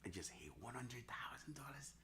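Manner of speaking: half-whispered to oneself; real hushed disbelief at a milestone; hushed aside. Real, not acted. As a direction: say it under your breath, barely voiced. Private aside on hitting a milestone.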